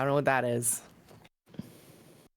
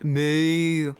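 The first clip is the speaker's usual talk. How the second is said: put-on British accent; 4 dB louder than usual. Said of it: "I gotta do it in a British accent".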